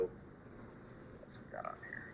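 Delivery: muttering to self. Real, not acted. Muttered aside while checking listeners.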